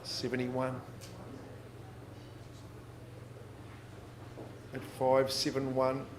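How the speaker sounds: brisk auction patter; auctioneer calling bids. Acted. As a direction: sell it from the rostrum.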